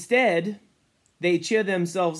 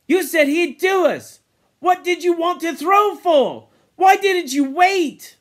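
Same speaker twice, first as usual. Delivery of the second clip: loud, high, angry challenge; heated accusation; 12 semitones higher, 8 dB louder than usual. Acted. Ralph challenging Jack over the boar, angry shout. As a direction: fling the questions as accusations.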